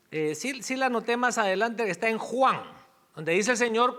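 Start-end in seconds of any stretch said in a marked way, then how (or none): none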